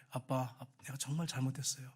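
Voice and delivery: quiet, understated imitation of a sorry daughter; soft, low confession to a parent; a touch of tearful remorse; retold tearful apology. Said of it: "울면서 그러더래요".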